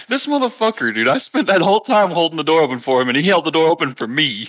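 high-pitched, indignant griping; hammed-up indignant rant; mock outrage. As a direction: act aggrieved at being outdone. Acted complaint of outdone door-holder.

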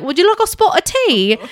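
high, loud mock-British voice; British accent. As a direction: put on a playful, high-pitched British accent. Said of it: "speak in British accents the whole time".

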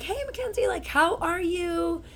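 imitating the pediatrician. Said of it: "And she's like".

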